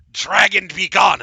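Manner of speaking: loud, high mock-heroic decree; boastful halfling's heroic pose; heroic command. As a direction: boom it like a fake hero. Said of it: "and I said".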